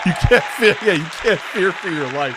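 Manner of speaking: mocking disbelief. Real, not acted. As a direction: ridicule him, laughing at the idea. Mocking the other streamer.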